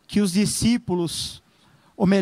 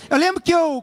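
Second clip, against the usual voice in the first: ordinary talk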